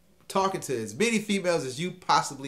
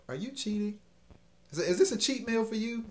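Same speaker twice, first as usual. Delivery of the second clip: high playful question; 9 semitones higher, faster than usual. Retold or imitated. Retold asking her, high voice.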